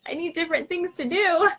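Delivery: pushing it up in mock despair; exasperated rise. Retold frustration with symptoms.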